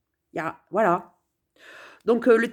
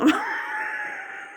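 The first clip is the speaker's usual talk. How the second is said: shrieking laugh; 4 dB quieter than usual. Real, not acted. Laughs at the ringing phone.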